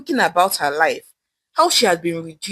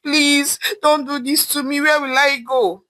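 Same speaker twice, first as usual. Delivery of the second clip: higher pleading, please after please; begging; 6 semitones higher, slower than usual. Acted. Wife begs husband not to throw her out, high desperate pleading. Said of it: "begging and pleading".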